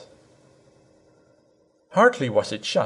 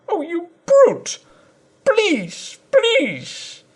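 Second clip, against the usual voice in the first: much higher, slow tearful begging; pleading wife; much slower than usual. A narrator's reading. Wife to her husband, a high tearful plea.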